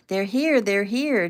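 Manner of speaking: excited high holler, each HERE swooping up; loud, high announcing shout. A narrator's reading. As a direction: call it out from downstairs. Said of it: "Taylor hollered from somewhere downstairs".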